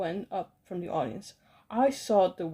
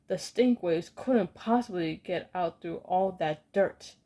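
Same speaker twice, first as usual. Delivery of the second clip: usual speaking voice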